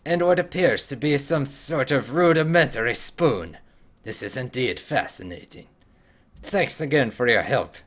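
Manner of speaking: a hint of a Scottish dwarf; Scottish accent. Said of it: "doing the Scottish voice".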